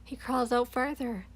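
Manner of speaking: plain reading voice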